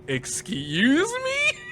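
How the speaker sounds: drawn-out, high, indignant "Excuse me?"; indignant Irina. Retold or imitated. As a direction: let her offence stretch out slowly. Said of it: "for Irina to go".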